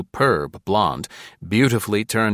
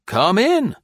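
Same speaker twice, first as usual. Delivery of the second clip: much higher invitation in the neighing voice; high neighing colonel; 13 semitones higher, 4 dB louder than usual. A narrator's reading. Colonel invites the lieutenant in, high and neighing. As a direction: wave him in politely.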